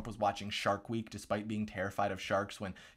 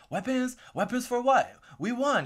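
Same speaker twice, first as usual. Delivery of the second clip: much higher mock-innocent voice; feigned innocent surprise; 12 semitones higher, 5 dB louder, slower than usual. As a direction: play baffled innocence, pitched way up. Castro acted answering request for weapons.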